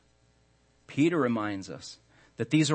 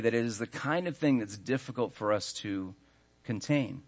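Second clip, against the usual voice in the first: ordinary talk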